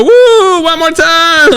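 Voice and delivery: high, loud whoop and chant; imitated cheering crowd. Audience eggs comedian on to hear her, excited cheering. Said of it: "They go".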